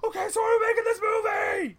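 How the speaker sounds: high-pitched holler; yelling. Acted. Asking if the film is approved, yelling. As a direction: call it out far above normal pitch. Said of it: "just keep it down a bit".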